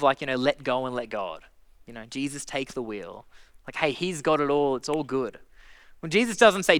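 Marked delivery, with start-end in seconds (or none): 0.4-5.4 low, soft mock of a lazy slogan. Preacher mocking a complacent churchgoer's slogan, low and offhand. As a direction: toss it off like a careless cliché. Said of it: "that attitude of".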